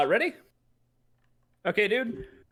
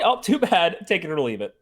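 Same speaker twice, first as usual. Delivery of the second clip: sales pitch; 4 semitones higher, 10 dB louder than usual. Acted. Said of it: "let's start from the top".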